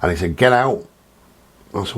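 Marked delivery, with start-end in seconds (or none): 0.4-0.9 teacher's sharp order. Retold or imitated. Teacher ejecting pupil.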